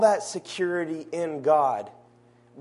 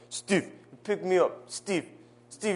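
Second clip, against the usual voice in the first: put-on light Haitian Creole accent, calling for Steve; a sad attempt at a Haitian girl's accent; Haitian accent imitation; 3 semitones higher than usual. Preacher imitating a Haitian girl begging to be picked up, light Haitian accent. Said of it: "That was my sad attempt at her accent".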